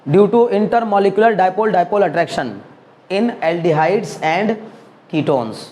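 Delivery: very slow, word by word for note-taking; slow dictation. Shown as a demonstration. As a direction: dictate each word slowly so they can write it. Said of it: "वो भी अभी बस लिख देना". (धीरे-धीरे लिखवाते हुए)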